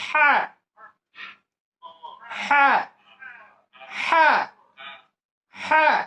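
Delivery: "হ্যাঁ" as a high, forceful breathy ha from mid-throat; laugh-like ha. Shown as a demonstration. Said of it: "একটু হাসার আওয়াজ দিয়ে বলতে হবে". (হাসির আওয়াজ দিয়ে উঁচু গলায় "হ্যাঁ")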